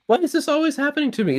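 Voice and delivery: very high, fast, squeaky wail; whiny lament. Acted. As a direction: wail it like the world is against you. Iwi laments his bad luck.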